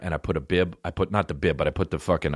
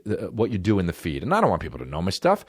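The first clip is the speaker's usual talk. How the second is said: ordinary talk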